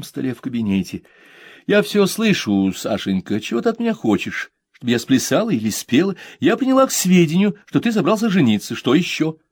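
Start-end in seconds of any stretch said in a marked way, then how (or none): 1.7-9.4 lightly voiced mother, dry and ironic. Mother answering her son, dryly amused. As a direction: brush the son off with mock patience.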